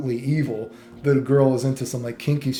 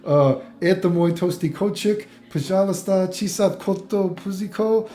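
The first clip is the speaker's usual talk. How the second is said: higher-pitched mock attempt at Russian small talk; pretend pleading in Russian; 5 semitones higher than usual. Vlogger imagining talking himself out of trouble in Russia, mock pleading. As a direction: throw out random Russian phrases, pitched up. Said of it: "What Russian do I say to get myself out of trouble".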